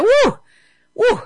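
high, loud whoop of joy; joyful whoop. Retold or imitated. Patient rejoicing at being alive, loud high whoop. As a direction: whoop like you just came back alive.

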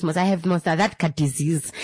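ordinary delivery

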